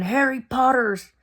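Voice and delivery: half-hearted imitation of a teacher's scream; McGonagall's mock scream. Teacher catching Harry after his dive, mock scream. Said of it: "McGonagall comes out screaming".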